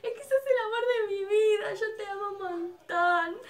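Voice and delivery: high, wailing fan confession; hammed-up tearful declaration, loud and high; mock crying. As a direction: imitate a sobbing fan pouring it out. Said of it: "empiezo a llorar".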